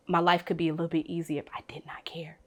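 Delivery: hushed, breathy confession to herself; whispered wish. Real, not acted. Admitting to friends she wishes not to care, whispering. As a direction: whisper it like a secret you shouldn't want. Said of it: "I'm like".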